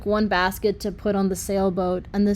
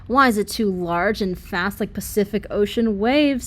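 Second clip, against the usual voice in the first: ordinary delivery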